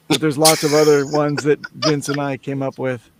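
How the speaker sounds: ordinary talk, nothing marked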